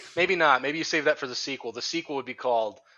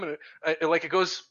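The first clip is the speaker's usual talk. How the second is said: ordinary talk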